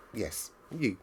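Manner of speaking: ordinary delivery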